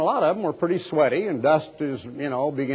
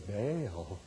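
"Baal" breathed much softer and lower, hushed and reverent; 6 semitones lower, 12 dB quieter than usual. Shown as a demonstration. Preacher shows Baal worshipers saying "Baal" in a hushed, reverent way. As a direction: whisper "Baal" like a holy name. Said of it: "They said".

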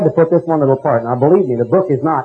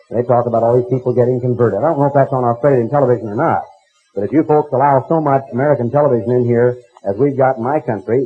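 ordinary delivery

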